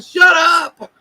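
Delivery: sharp high yell at the cat; sleepy shout. Retold or imitated. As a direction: snap it out, half asleep and annoyed. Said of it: "you'll hear him turn around and be like".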